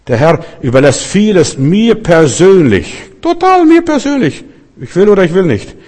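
hammed-up voice of a believer who insists he decides everything; mock self-important believer. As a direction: puff it up like someone who thinks everything is up to him. Mocking a self-willed believer's claim.

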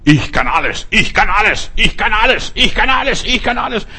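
mock the bragging evangelist, each repeat shriller; boastful preacher. Preacher mocks boasting evangelists with a climbing, mocking boast. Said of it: "So viele Christen bilden sich so viel ein".

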